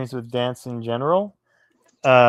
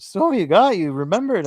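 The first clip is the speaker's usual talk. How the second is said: high, louder imitation of astonishment; mock surprise; 7 semitones higher, 4 dB louder than usual. Tango teacher to returning student who remembered the steps, mock surprise. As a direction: act out a surprised teacher, pitch swooping up.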